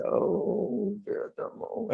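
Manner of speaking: a pained, hushed groan of regret; whispered self-reproach. Retold or imitated. Monk alone in his hut regretting his words, whispering. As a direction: sigh out your own name in a whisper.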